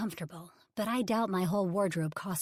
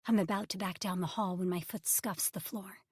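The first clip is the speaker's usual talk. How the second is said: plain reading voice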